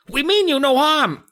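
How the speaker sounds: very high little gnome insisting. Acted. Chip repeating his reassurance, very high voice. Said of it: "Chip repeated".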